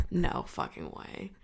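slow, hushed mock disbelief; soft, breath-only reaction; stunned whisper. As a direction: whisper it, drawn out, in retold shock.

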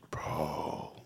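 whispered, stunned bro; hushed bro. Retold or imitated. Friend whispering bro after reading the texts. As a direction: breathe it out in shock. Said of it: "kinda like what I just did with you".

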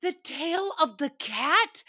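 mock disbelief. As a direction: repeat it as if you can't believe it.

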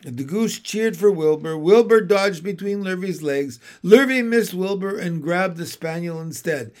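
ordinary delivery, nothing marked

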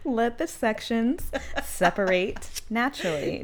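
reading while cracking up; laughing through the words. Real, not acted.

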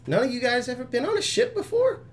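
high squeaky little creature voice; little rock guy voice. Acted. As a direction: pitch way up for the tiny rock guy. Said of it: "and goes".

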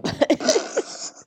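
laughing while talking fast; giggly high laugh through words. Real, not acted. Laughing through coughing fit.

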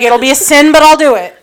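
mock threat with a laugh; joking threat. Joking to the priest, playful and laughing.

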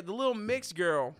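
ordinary talk, nothing marked

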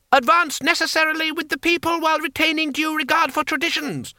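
high monotone rallying chant; crowd slogan. A narrator's reading. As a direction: cry out a stilted slogan on one high note. Crowd member cries out a slogan.

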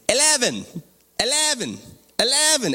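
high-pitched fake Irish, each word swooping down; mock Irish accent. Irish men telling the elevator level eleven, mock Irish accent. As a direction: repeat it as a mock Irishman commanding a machine. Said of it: "There's these Irish men in an elevator".

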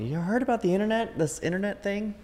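high-pitched, wondering questions; naive nineties person. Acted. Nineties people talking about new technology, mock-naive and high. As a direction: imitate clueless early internet newcomers in a high voice. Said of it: "like those people in the nineties".

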